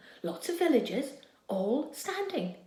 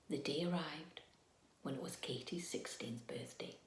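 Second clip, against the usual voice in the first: low, very soft whisper; whispered big-day announcement; hushed build-up; 8 semitones lower, 13 dB quieter than usual. A narrator's reading. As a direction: lean in and whisper.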